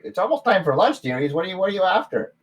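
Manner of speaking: high, loud motherly gnome voice; gnome lady. Acted. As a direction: call them dearies fondly.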